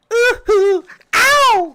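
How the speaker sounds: mock high squeal of pain, very high and loud. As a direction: yelp it like the pop star, way up high. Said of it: "if that happened to [name]".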